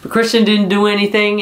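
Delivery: ordinary delivery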